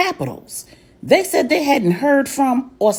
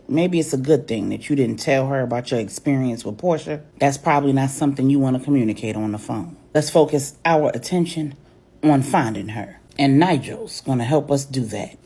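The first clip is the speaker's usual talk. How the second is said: Uncle Manny's lowered voice; 7 semitones lower than usual. A narrator's reading.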